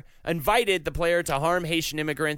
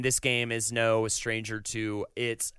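ordinary talk, nothing marked

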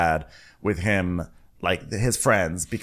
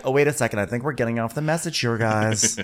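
usual speaking voice

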